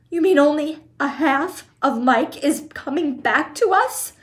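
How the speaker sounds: rising high in horror; a mother horrified at the news; horrified cry. A narrator's reading. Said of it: "she cried".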